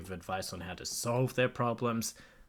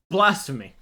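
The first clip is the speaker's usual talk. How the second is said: loud, higher hammed-up cry; mock outrage; 6 semitones higher, 12 dB louder than usual. Streamer pretending offense at the AI. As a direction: cry blasphemy in fake indignation.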